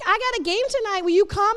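put-on high, hopeful kid voice asking a favour; eager teen asking. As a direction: play the teen inviting a leader. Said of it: "If you guys were like".

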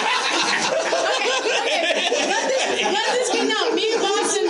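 giggling as she admits it; laughing. Real, not acted. Admitting to the host she fights with Boston, laughing throughout.

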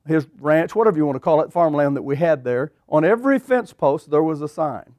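ordinary delivery

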